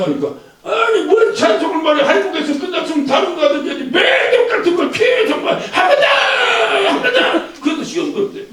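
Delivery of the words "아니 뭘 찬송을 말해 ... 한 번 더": high, raised, irritated complaint; mock the fed-up churchgoer; annoyed rant. Irritated churchgoer storming out, high mock rant. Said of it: "성질나게 나가더라고".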